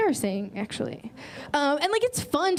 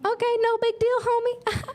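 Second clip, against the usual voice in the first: much higher, playful triumphant voice; high mock-casual; 10 semitones higher than usual. Comic reading a Tinder reply in a high mock-casual voice. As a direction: squeak it out like you've caught him. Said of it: "So I'm like".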